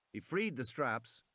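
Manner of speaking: ordinary delivery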